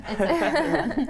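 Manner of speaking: high, slow, laughing reassurance; breezy bragging through a laugh; hammed-up cocky reassurance; mock confident. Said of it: "I was like".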